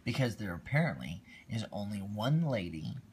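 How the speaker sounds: ordinary delivery, nothing marked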